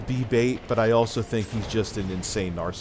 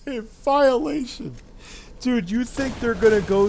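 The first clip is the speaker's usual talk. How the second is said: high, slow disbelief; incredulous; 13 semitones higher than usual. Real, not acted. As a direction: scoff at the word. Mocking incredulous repeat.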